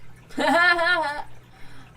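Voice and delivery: loud high burst of laughter; big laugh. Real, not acted. Reacting to a horse joke.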